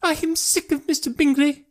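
very high-pitched, fed-up wife; exasperated high wife voice; wife crying out. A narrator's reading. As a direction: cry it out in a high put-on voice. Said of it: "cried his wife".

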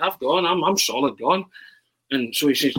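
ordinary talk, nothing marked

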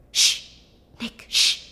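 sharp unvoiced shush; hushing. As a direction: hush him fast and breathy. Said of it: "brother hushing at the door".

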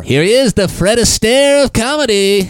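high, loud showman announcing the act; mock emcee intro. Comic pretends to introduce the dancing comic, loud and high. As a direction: hype up the dancing comic like a cheesy host.

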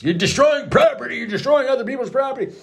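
father's retold shout at his son; retold angry yell. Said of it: "his reaction was obviously anger".

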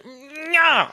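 high, whiny mock pout; a grown man pouting like a child; pouting tantrum. Villain pouting over his foiled plan, mock whiny. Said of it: "a literal pout tantrum".

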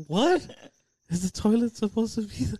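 high, quick incredulous question; baffled echo. Retold or imitated. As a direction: repeat it back in retold disbelief. Echoing kid's question in disbelief.

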